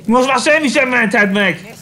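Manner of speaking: hammed-up villain taunting; mock taunt.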